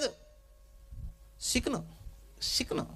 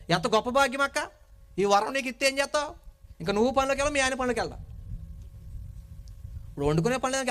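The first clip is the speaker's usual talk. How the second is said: ordinary delivery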